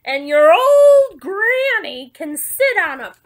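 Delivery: very loud, drawn-out shout; big, high storybook yell; yelled taunt. Acted. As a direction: holler the insult after the wolf, stretching it. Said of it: "the third little pig yelled".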